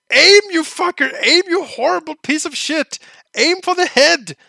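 furious high shout at the character; yelling at screen. Real, not acted. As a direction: scream at him to aim.

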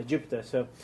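ordinary delivery

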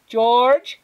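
high, loud shout of a name; mother yelling through the house; shouted call. A narrator's reading. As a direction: call out sharply for someone in another room. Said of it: "shouted Flora's mother".

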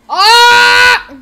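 high, sustained shout; abrupt full-voiced "ah"; loud yell. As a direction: let out a sudden high play-scream. Character cries out in the story.

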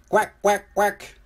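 three short, high quacks; happy duck quacks. A narrator's reading. Ducks splashing in their new home, high happy quacking. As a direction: squawk like contented storybook ducks.

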